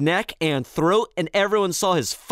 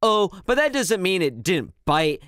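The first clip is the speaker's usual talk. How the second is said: usual speaking voice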